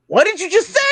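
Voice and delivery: high, incredulous question; taken aback dad. Retold or imitated. Dad answering a request to marry his daughter, incredulous. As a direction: imitate a dad who can't believe his ears.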